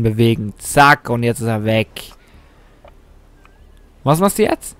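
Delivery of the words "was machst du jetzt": high sing-song question rising on du; teasing taunt. Acted. Gamer teasing the computer opponent, high mocking.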